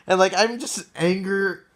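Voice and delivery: normal talking voice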